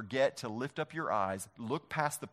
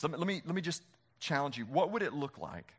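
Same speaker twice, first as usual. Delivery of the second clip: ordinary delivery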